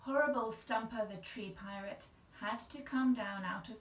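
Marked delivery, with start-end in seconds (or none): none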